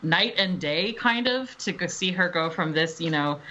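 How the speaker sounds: ordinary delivery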